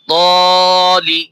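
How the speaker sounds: very slow, long stretched vowel; slow Quranic spelling blend; drawn-out blend. Shown as a demonstration. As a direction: stretch the alif one beat. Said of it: "এক আলিফ করে টান দিয়ে পড়তেছি".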